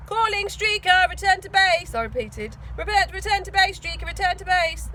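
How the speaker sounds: like calling a fighter plane; radio call. Acted. Radio call over phone.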